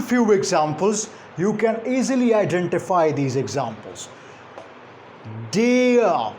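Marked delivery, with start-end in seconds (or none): 5.5-6.3 "Dear" as a drawn gliding "dee-uh", silent r; r-less demonstration. Shown as a demonstration. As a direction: model "Dear" clearly, higher, no r. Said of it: "R is silent".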